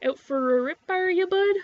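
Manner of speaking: rising inland Canadian "are you, bud?"; wide-vowelled inland Canadian buddy talk; inland Canadian accent. Acted. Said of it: "That's, like, the inland accent".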